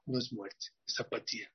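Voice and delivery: apatía whispered almost without voice; preacher whispering the word apatía; hushed punchline. Shown as a demonstration. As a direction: drop to a breathy whisper on apatía. Repeated punchline of the lesson.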